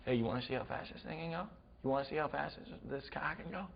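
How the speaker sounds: hushed, conspiratorial New Englander; New England accent. Retold or imitated. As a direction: softly dare them in New England accent. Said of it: "had the best accent ever".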